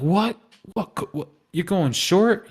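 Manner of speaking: high, incredulous double-take; mock viewer disbelief. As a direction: act like the viewer can't believe it. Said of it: "You're like".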